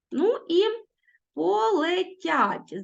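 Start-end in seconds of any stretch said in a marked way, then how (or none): 1.4-2.8 полетять very slow and high, unstressed е leaning toward и; slow high demonstration of полетять; careful pronunciation. Shown as a demonstration. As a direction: stretch полетять so the е sounds close to и. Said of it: "Зверніть увагу, що у слові полетять".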